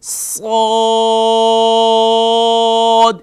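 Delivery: صاد opened with a whistling hiss, then held long; whistling start. Shown as a demonstration. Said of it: "شروع میں سیٹی جیسی آواز نکالیں گے".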